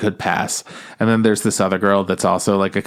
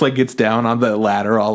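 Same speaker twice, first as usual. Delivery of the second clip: ordinary talk, nothing marked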